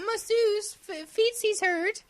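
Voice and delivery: put-on high, slow, babyish demand; pampered mock whine. Podcaster jokingly calling a masseuse for sore feet, pampered. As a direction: playfully order the help around.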